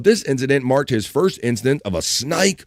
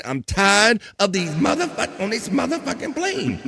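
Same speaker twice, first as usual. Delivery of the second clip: lightly voiced movie line, pitched higher; a hint of the famous snake rant; high, mock Samuel L. Jackson line; Samuel L. Jackson quote; 8 semitones higher, 3 dB quieter than usual. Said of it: "Samuel L. Jackson, right?".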